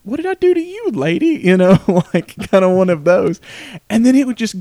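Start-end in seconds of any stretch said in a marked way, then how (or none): 0.0-1.4 baffled. Retold or imitated. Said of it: "Bria was just like".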